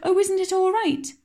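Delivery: put-on girl crying out in anguish to Marilla. A narrator's reading. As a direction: beg to hear it's fine. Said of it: "cried Anne with a look of anguish".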